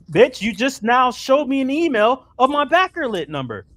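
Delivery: high, loud, exasperated outburst; frustrated rant. Real, not acted. Backer rants at the company over changed numbers, loud and frustrated. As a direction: raise pitch and volume, accusing them hotly. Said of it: "I'm so fucking frustrated".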